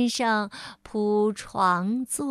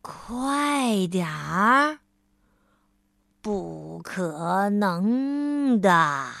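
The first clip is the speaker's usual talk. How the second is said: very slow, lower drawl; slow reply; 4 semitones lower, much slower than usual. Acted. Mr. Slow answering Mr. Busy, very slow and low. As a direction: stretch it out lazily. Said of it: "慢吞吞先生回答说".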